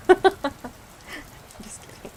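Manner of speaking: laughing through just kidding; laughing aside. Real, not acted. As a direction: giggle it out. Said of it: "Being Southern is a struggle".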